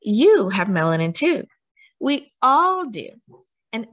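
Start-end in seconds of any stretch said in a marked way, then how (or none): none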